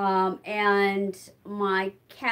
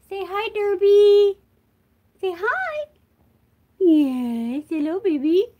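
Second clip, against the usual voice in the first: pet voice; 10 semitones higher, 3 dB louder than usual. Real, not acted. Talking to her cat.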